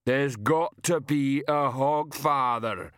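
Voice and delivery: big simple man, stubbornly insistent; rumbling giant. A narrator's reading. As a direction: drag each word out heavily. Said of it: "Banjo rumbled".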